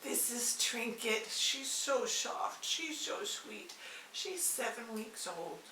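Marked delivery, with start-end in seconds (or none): 1.3-3.7 cooing. Real, not acted. Cooing softly to the tiny kitten. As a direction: coo it to the kitten.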